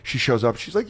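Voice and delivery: ordinary talk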